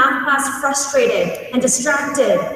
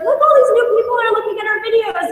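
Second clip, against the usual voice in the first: higher, fast and thrilled; excited kids; 9 semitones higher, faster than usual. Retold or imitated. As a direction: blurt it out with delight. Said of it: "they'd be like".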